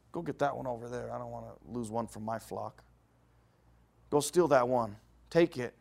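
a quiet, sneaky command; sly aside. Acted. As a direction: mutter it like a greedy schemer. Rich man orders the theft.

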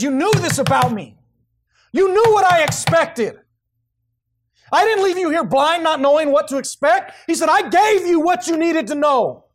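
high, loud, slow accusing voice; loud slow accusation from God; booming rebuke. Acted. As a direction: confront him with full force. God confronting the servant.

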